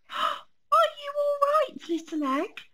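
high anxious cub after falling in mud; worried little bear. Acted. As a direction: fret over the egg in a high small voice. Fred checks the acorn after falling.

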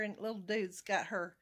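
ordinary talk, nothing marked

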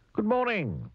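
bright high cheery hello; cheerful greeting. Acted. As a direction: greet them with a big happy voice. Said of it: "said Mister Happy".

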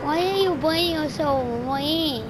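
slow, whiny put-on child voice; fake little kid. Troll protests to bullies, slow fake-kid voice. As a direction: draw it out like a wronged small child. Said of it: "I know you're not a little kid".

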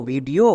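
teacher blends the spelled letters into ভিডিও; ভিডিও pronounced as one smooth word; model pronunciation. Shown as a demonstration. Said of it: "যেমন V I D E O".

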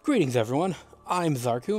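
usual speaking voice

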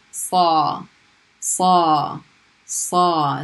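saw with a heavy emphatic s; heavier s. Shown as a demonstration. Teacher turning English saw into a heavy s. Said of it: "make that S sound heavier".

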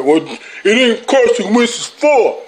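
slow, mock-whiny fan voice; mocking Eagles fan. Vlogger mocking an Eagles fan's excuse, slow and whiny. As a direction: drag out the excuse like a sulking fan. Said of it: "fans coming out of nowhere".

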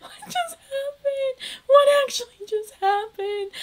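barely holding back tears; teary disbelief. Real, not acted. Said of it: "I am so overwhelmed".

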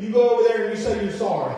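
stern parent. Retold or imitated. Parent ordering a kid to apologize to his brother, stern. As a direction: lay down the law like a parent. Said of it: "my mom and dad, they told me, they said".

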